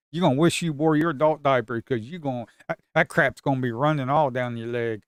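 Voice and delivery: ordinary talk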